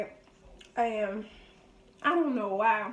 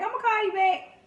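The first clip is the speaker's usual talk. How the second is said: breezy brush-off; 10 semitones higher, 5 dB louder than usual. Retold or imitated. Casually brushing off dad's call.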